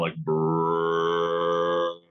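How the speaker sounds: short rolling brrr grunt; deer grunt. Shown as a demonstration. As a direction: imitate a buck grunting. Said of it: "just to kind of".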